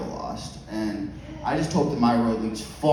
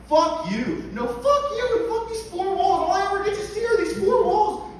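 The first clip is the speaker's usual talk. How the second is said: high furious screaming fight; angry yelling. Retold or imitated. Parents fighting, acted by comedian.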